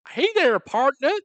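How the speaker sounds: hammed-up, loud, high-pitched Texan cowboy; drawn-out, over-the-top Southern American twang; American cowboy accent. Asked to give friends an American, hammed-up cowboy accent. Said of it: "give us your American".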